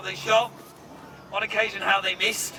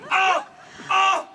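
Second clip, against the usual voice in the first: loud, high cry of pain; 8 semitones higher, 3 dB louder than usual. Acted. Archer acts a wounded warrior for the crowd, pained yells. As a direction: cry out as if struck by an arrow. Imagine being wounded terribly with an arrow.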